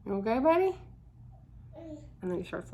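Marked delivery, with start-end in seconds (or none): 0.0-0.8 higher, louder cooing check-in. Real, not acted. Mother breaks off to ask her baby son, cooing.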